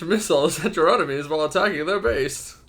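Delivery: loud, grand, pompous read. Acted. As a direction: puff up like a pompous herald. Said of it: "this is the Pompous voice".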